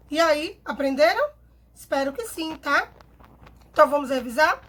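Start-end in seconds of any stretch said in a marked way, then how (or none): none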